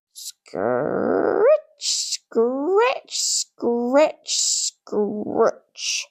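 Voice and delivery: scratching sound. A narrator's reading. Noise of a hoe imitated.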